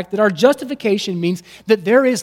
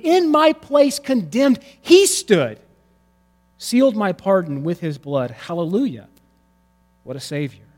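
hymn recitation; 4 semitones higher, 4 dB louder than usual. Acted. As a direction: recite the hymn like a prayerful poem.

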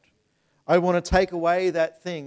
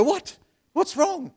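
much higher, louder startled question; alarmed; 14 semitones higher, 6 dB louder, slower than usual. Retold or imitated. Father startled by his son's sigh, alarmed high question. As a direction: jump in worried, pitch flying up. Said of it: "immediately I'll go".

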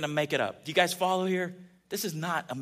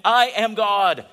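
proclaimed; 5 semitones higher, 9 dB louder, slower than usual. Acted. Preacher proclaiming climax.

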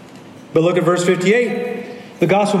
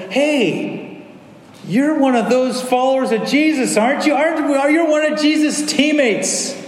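put-on servant girl accusing; 6 semitones higher than usual.

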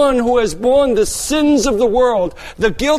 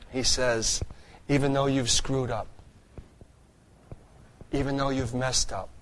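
soft, much quieter preaching, close and tender; hushed sermon; 5 semitones lower, 6 dB quieter than usual. Acted. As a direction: speak it gently, as reassurance to the congregation. Soft, intimate preaching passage.

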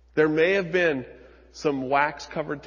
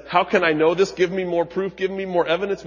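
louder, insistent asking for proof; prideful demand; 5 semitones higher, 7 dB louder than usual. Acted. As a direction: push hard, like a proud man wanting more.

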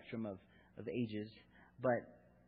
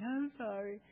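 put-on tearful little girl; 10 semitones higher, slower than usual. Crying daughter imitated.

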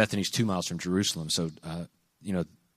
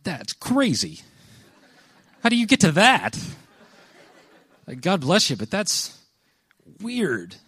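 high, loud incredulous reaction; 9 semitones higher, 4 dB louder, slower than usual. Retold or imitated. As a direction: play it as a baffled friend, much higher. Retelling his reaction to a claim.

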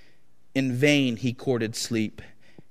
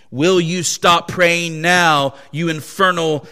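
higher, louder, slow and menacing; enraged master; 4 semitones higher, 7 dB louder than usual. Acted. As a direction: hurl each word down at him. Master's enraged final threat.

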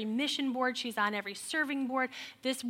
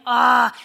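loud startled exclamation; a loud burst of shock at herself; much louder shocked cry; shocked gasp; 14 dB louder than usual. Retold or imitated. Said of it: "And I'm like".

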